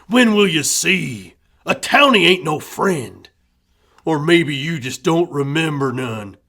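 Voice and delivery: loud, hard angry outburst; furious father. A narrator's reading. Grieving father raging about losing another son, loud. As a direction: rage at them for trusting townies.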